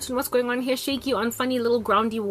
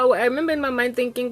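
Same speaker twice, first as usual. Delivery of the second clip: usual speaking voice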